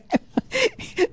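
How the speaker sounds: a real high-pitched burst of laughter. Real, not acted. Host laughing at his own UN joke, high laugh. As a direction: let out a sudden high laugh.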